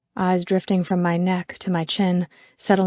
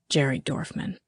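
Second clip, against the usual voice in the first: low, hushed murmur; whisper; 4 semitones lower, 7 dB quieter than usual. A narrator's reading. Garrett names the man for Callie, murmuring. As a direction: slip the name quietly into an ear. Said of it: "Garrett whispers again".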